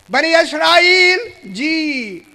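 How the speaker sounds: slow, high, whining call with a tearful catch; mock pleading call. Preacher voicing God calling the Israelites, in mock pleading. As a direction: stretch it out like a plea. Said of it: "तभी मैंने जी कहा".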